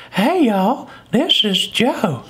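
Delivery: put-on high wheezy old man with whistling S's; high creepy old guy, whistle each S; Herbert. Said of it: "just like Herbert".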